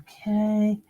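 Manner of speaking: breath let out after holding it; releasing held breath. Real, not acted. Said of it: "I'm holding my breath".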